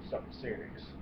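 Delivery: soft, whispered aside; hushed realization. Retold or imitated. Realizing a friend's news is serious, whispered and soft. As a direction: breathe it as it dawns on you. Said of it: "He's never told me to shut up".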